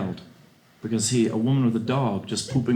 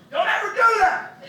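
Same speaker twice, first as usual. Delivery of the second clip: very high, slow furious yell; shouted warning; slower than usual. Real, not acted. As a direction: scream the rule at them.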